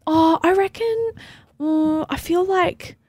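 high, hesitant voice turning the question back; voice goes up. Shown as a demonstration. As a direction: float it up high and slow to deflect. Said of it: "And say what?".

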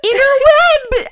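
high, slow, loud disbelieving cry; shrieking mock disbelief at the word; incredulous exclaim. Real, not acted. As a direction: stretch it out in amazed mockery. Repeating his odd word.

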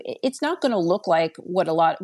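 ordinary talk, nothing marked

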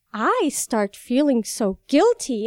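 ordinary delivery

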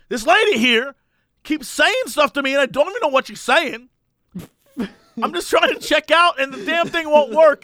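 hammed-up irate shopper; mock angry complaint. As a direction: vent to the helper. Angry customer quoted.

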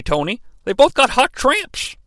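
a hint of a brash commentator tossing it off; quoting a commentator's crude line; Mark Madden quote. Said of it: "as Mark Madden would say".

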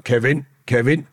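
best mate calling Kevin from the next cell, high and urgent. Retold or imitated. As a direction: call out to get his attention.

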